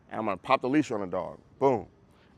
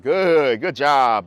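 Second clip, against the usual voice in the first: dog praise; 2 semitones higher, 6 dB louder, much slower than usual. Retold or imitated.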